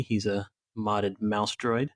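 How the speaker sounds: ordinary delivery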